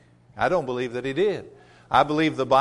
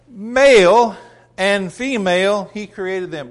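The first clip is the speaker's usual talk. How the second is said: raised, forceful Bible reading with stretched key words; emphatic scripture reading; 3 semitones higher, 4 dB louder than usual. Acted. Preacher reading Genesis to the congregation, emphatic scripture reading. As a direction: proclaim the verse, punching words like "Male".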